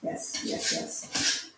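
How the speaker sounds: ordinary talk, nothing marked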